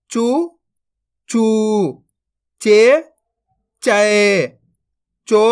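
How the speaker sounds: syllable drill, short rising then long level-falling vowels; barahkhadi recitation. Shown as a demonstration. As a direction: recite each syllable apart for pupils to repeat, stretching every second one. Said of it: "मैं किस तरीके से इनको पढ़ रहा हूँ". (बारहखड़ी का पाठ, एक-एक अक्षर खींचकर)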